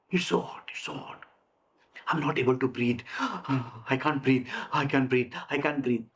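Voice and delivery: low, fading to a gasping whisper; gasping, can't breathe. Shown as a demonstration. Teacher acting a student who can't breathe, low and gasping. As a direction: act out panicking for air. Acts someone unable to breathe.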